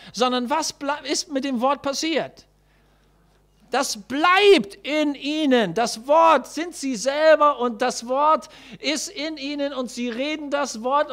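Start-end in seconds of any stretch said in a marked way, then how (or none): 3.7-5.7 "bleibt" slow, loud and drawn out; stress on bleibt. Shown as a demonstration. Preacher to the church, dwelling slowly and loudly on "bleibt". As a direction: lean hard on "bleibt", slowly. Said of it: "Nehmt bitte die Betonung der Bibel da".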